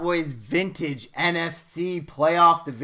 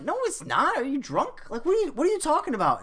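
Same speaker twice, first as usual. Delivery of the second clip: high-pitched mock protest; retold incredulous denial; 11 semitones higher than usual. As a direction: protest in disbelief, voice shooting up. Retold argument with wife.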